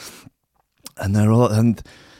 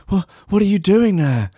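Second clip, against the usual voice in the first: higher, stammering mock panic; alarmed; 7 semitones higher than usual. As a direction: blurt it out startled. Sleeper alarmed by apparitions.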